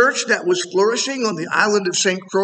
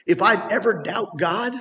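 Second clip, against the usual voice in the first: ordinary talk, nothing marked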